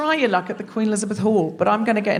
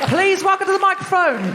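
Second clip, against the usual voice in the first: high, ringing stage introduction; announcer's call-up; 9 semitones higher than usual. Real, not acted. Host bringing the comedian onstage, high excited announcement. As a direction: announce the act to rouse the crowd.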